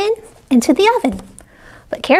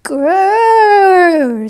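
louder growl from outside the door; monster growl; 3 semitones higher, 4 dB louder than usual. Acted. Monster growling at the door to scare Posy, playful growl. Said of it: "said a voice".

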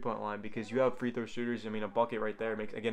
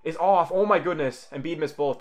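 excited; 6 semitones higher, 8 dB louder than usual. Real, not acted. Live reaction to the second miss.

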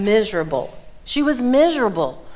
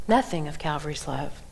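quiet echo; 6 semitones lower, 7 dB quieter than usual. Shown as a demonstration.

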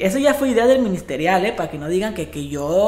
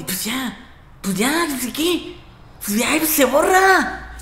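high, slow, drawn-out sneer; mocking kid; 7 semitones higher, 3 dB louder, much slower than usual. The boy taunting the officer, high and slow. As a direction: brush it off like a bratty teen.

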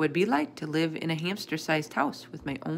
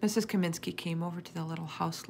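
ordinary delivery

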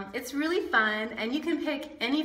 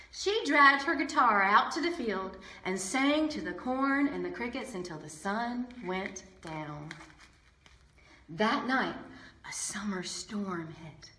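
usual speaking voice

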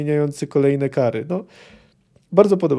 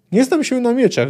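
higher, lightly winded delivery; acted panting; 8 semitones higher, 3 dB louder than usual. A narrator's reading. Winded poet answers Geralt about swords, lightly acted panting. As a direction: gasp it out as if just off the stairs. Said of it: "wysapał poeta".

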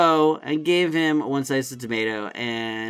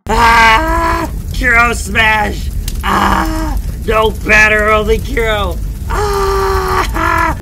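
very loud, high roaring smash; Hulk rage roar; 7 semitones higher, 8 dB louder, much slower than usual. Pretend. Worker acting out a Hulk rampage over the sub, roaring loud.

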